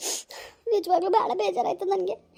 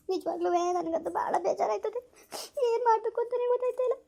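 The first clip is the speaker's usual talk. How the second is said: crying; 3 semitones higher than usual. Real, not acted. Woman telling viewers how hurt she is, crying. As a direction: let the tears break through. Woman breaks down after the call.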